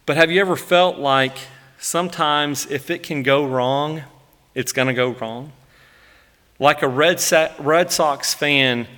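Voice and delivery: usual speaking voice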